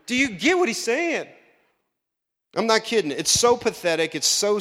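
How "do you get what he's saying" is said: incredulous. Retold or imitated. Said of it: "You know, I'm like".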